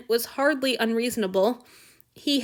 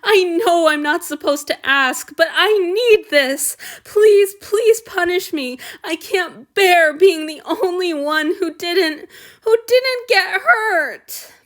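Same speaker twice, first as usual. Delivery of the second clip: high, loud pleading close to tears; plead, voice breaking, slowing down; tearful begging; 8 semitones higher, 8 dB louder, slower than usual. A narrator's reading. Man begs to be punished.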